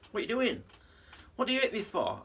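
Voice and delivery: high, indignant cry after a punch; outraged. Retold or imitated. Punched union man protests to the local, high outraged cry. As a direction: yelp it out, stunned and hurt. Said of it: "he says".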